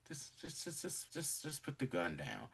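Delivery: near-whispered, halting imitation; very soft, tentative retold plea; hushed stammering plea. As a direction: stammer it under your breath to calm him. Retold plea to lower gun.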